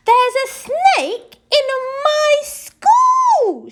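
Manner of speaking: slow, high, outraged shriek; shouted. Acted. Headmistress bursting into the playground, outraged shriek. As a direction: shriek it out, stretching every word. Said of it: "she shouted".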